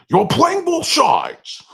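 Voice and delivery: loud, slow, high Scottish bellow; Sean Connery impression. Brother punching his head and yelling, loud Connery impression. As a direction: bellow it slowly like a movie Scotsman. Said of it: "He wouldn't stop doing a Sean Connery impression".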